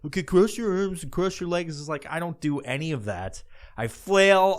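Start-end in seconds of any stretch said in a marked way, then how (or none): 0.0-1.8 lightly voiced, rote lifeguard patter; a hint of a bored teen lifeguard; bored lifeguard instructions. Imitating the teen lifeguard reading out rules, lightly voiced. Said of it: "be like".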